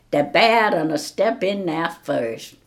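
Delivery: usual speaking voice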